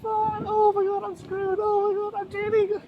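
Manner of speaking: high, loud hammed-up wail; crying. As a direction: bawl the words in a squeal, breaking into sobs. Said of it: "are you crying?".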